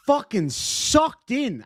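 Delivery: drawn-out, high mock Australian twang on sucked in; slow, hammed-up Aussie phrasing. Streamer tossing an Australian saying to viewers, mock Aussie accent. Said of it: "as my Aussie friends would say".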